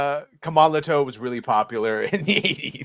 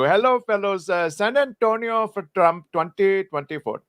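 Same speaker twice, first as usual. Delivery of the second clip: higher, hammed-up Indian accent; comic high Indian-accented reading; 4 semitones higher than usual. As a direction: read it out in a put-on Indian accent. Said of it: "read some super chats in the voice".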